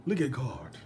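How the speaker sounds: normal talking voice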